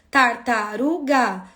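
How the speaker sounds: Tartaruga drawn out slowly, syllable by syllable; slow syllable demo. Shown as a demonstration. Teacher showing a child how to count syllables, slow and clear. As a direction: stretch Tartaruga so each piece can be counted. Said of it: "Quantas sílabas tem?".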